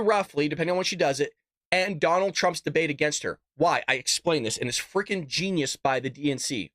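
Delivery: usual speaking voice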